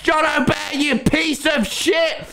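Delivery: hammed-up rage at the map maker; exasperated shouted curse; mock angry yell.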